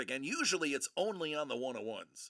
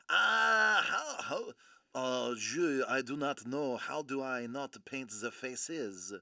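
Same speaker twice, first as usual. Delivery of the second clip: lower, hammed-up French accent after an opening wail; 5 semitones lower than usual. Painter lamenting that he can't draw faces, French accent. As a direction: put on a rusty mock French accent. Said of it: "I haven't practiced my, my French accent".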